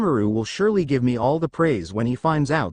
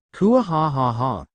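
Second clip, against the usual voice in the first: slow, hammed-up evil cackle; a drawn-out gloating mock-villain laugh; dragon's smug triumphant laugh; villainous laugh; slower than usual.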